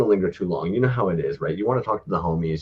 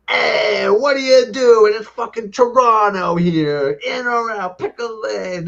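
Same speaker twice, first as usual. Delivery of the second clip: much higher, louder, slow imitation of a friend shouting at traffic; mock yell at drivers; 6 dB louder, slower than usual. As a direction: ham up a buddy barking at other cars. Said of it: "it would be just kinda like".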